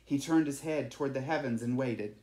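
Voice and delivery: usual speaking voice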